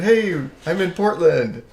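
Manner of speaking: re-enacted cheery shout-out; upbeat announcement. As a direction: call it out slowly to everyone. Acting out his announcement to followers.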